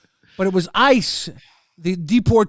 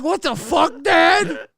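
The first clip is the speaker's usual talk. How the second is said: shocked shout; 9 semitones higher, slower than usual. Retold or imitated. Son yelling at his dad, mock outraged. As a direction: yell it in disbelief at Dad. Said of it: "I'm like".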